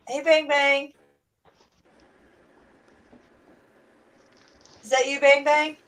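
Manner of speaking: high bright hello; excited greeting. Real, not acted. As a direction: greet a friend joining. Greeting a new arrival.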